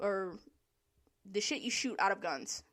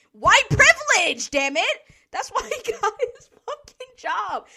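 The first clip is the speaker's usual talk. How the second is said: loud high outburst, laughing through it; exasperated shout; 10 semitones higher, 13 dB louder than usual. Real, not acted. Venting about the bad manager, loud laughing outrage. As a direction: shout it like you can't believe it.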